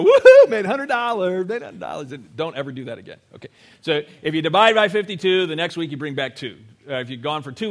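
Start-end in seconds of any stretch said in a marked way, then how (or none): 0.0-2.1 gleeful mock whoop, much louder, laughing; mock happy dance. Pastor mocking a giver skipping a week, gleeful laughing whoop. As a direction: ham up a giddy celebration. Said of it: "do, like, a happy dance and go".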